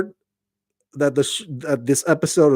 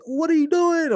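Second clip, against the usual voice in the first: high-pitched scolding question, much higher; mock scolding. Leader scolding survivors, mock high scolding. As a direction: scold them like a fed-up boss. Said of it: "she doesn't scold, like".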